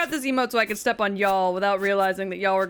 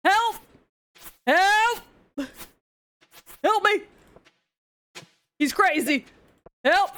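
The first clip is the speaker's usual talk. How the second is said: high, loud hammed-up cries for help; mock panic; 6 semitones higher, 4 dB louder, slower than usual. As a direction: plead in playful terror. Mock pleas for rescue.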